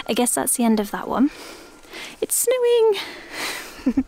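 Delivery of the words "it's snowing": high, slow playful announcement; playful joke. Real, not acted. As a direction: stretch it out as a joke. Joke as petals blow.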